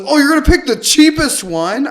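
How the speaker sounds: high, loud mock outburst of disbelief; retold screaming. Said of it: "he's screaming".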